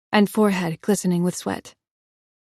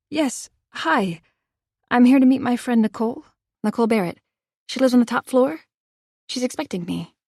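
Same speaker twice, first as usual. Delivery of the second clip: higher, bright, overly friendly; nervous bluff; 5 semitones higher, 5 dB louder than usual. Acted. Heroine bluffing the doorman, falsely cheerful. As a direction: fake a cheerful calm to slip past.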